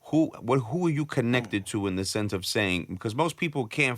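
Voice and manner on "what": usual speaking voice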